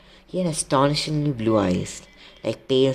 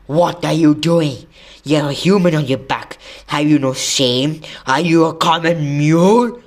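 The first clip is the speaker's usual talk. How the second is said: thundering; 4 semitones higher, 8 dB louder than usual. A narrator's reading. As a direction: thunder indignant questions, drawing them out. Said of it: "Bane thundered".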